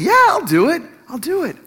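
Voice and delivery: high, bouncy, joyful yes; eager, joyful. Shown as a demonstration. Husband offers to fetch son, eager and joyful. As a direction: jump at the chance, pitch leaping up. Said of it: "said it eagerly, joyfully".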